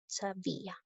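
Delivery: sabía said softly, the stress landing on the í of sabía; quiet model of sabía with the stress on the í; soft stress demo. Shown as a demonstration. Teacher models sabía for students, quietly. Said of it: "En sabía también hay hiato acentual".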